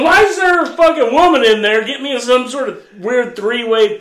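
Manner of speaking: high, loud exasperated rant; retold indignation. Retold protest to his sister.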